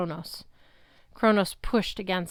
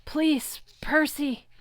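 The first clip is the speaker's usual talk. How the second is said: high, pained plea; desperate begging; 7 semitones higher, much slower than usual. Acted. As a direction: beg through the pain. Luke begging in pain.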